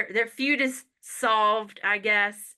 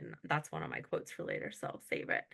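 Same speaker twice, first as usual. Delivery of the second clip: near whisper, much softer, faster; whispered aside; 12 dB quieter, faster than usual. Real, not acted. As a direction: drop it to a hushed aside.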